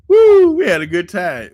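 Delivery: loud, high happy woo; joyful whoop. Real, not acted. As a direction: whoop with delight at the memory. Joyful memory of baking together.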